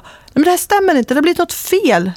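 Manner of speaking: the machine must be broken, say it in shock; high-pitched imitated alarm; shocked disbelief. Man shocked by red photo.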